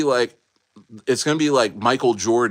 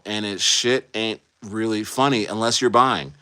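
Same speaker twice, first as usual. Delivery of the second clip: normal talking voice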